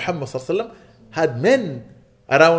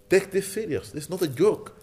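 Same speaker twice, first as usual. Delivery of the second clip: earnest urging; 5 semitones higher, 4 dB quieter than usual. Real, not acted. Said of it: "I don't know why I'm talking like this".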